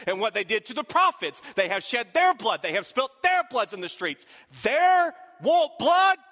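loud, high, rising to a slow shout; building preaching cry. Acted. Pastor voicing the angel's accusation to the church, building to a shout. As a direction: preach the angel's charge to a climax.

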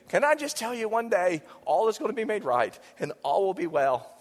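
ordinary talk

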